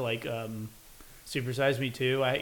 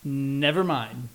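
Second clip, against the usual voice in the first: ordinary talk, nothing marked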